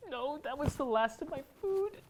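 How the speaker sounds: crying. Acted. Despair over lost food.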